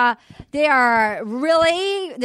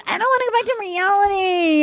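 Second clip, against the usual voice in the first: high, fast mock whine; hammed-up pleading in a higher pitch; mock wailing; 5 semitones higher than usual. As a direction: wail it like an overwrought contestant. Acted contestant quote, exaggerated.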